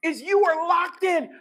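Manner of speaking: high, loud, slow proclamation; preaching shout. Real, not acted. Preacher to church, slow rising shout. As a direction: ring it out to the room.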